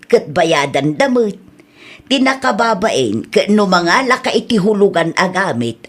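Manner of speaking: ordinary delivery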